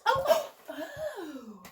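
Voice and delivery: gasp, then a bright laughing oh; delighted gasp. Acted. Host finding a letter in her bag, delighted gasp. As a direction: act thrilled at the discovery.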